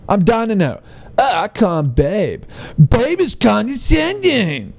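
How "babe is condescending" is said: mock offended scold. Mimicking an offended critic.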